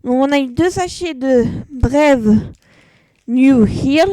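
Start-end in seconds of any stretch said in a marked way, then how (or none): 1.7-4.1 English name in a French accent. Real, not acted. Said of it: "excusez-moi pour mon accent anglais".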